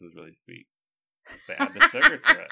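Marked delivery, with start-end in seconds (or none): 1.5-2.5 laughing at his own joke. Real, not acted. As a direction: says it through a laugh. Joking about sweet Starbucks.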